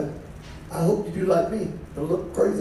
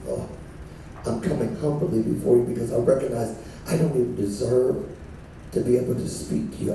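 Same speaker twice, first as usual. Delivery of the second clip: humble soft prayer; 5 semitones lower, 4 dB quieter than usual. Pretend. Voicing humble prayer, low and soft.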